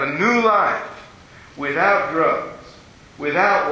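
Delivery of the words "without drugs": usual speaking voice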